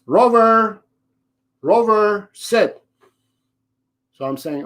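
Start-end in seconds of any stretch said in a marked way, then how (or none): 0.0-2.8 high, pleading repeated name before sit; imitating the owner. Trainer mocking the owner who repeats the name, pleading call. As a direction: mimic a flustered owner calling the dog twice. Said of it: "So the owner goes".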